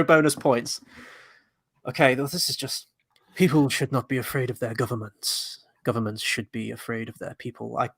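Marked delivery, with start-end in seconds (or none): 3.3-7.8 softer, low theatrical V voice; a hint of a masked revolutionary; V impression. Co-host quietly voicing V. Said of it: "It's V from V for Vendetta".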